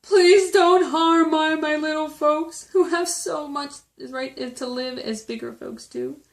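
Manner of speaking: high, drawn-out desperate plea; begging. A narrator's reading. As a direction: cry out slowly, pleading for your little friends. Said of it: "and begged".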